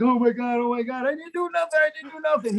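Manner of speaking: high-pitched frantic pleading; mock terrified denial, climbing in pitch; imitated panic. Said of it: "you could see the panic".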